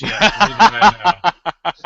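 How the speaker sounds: loud laughing admission. Real, not acted. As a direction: laugh through the words. Laughing at rambling.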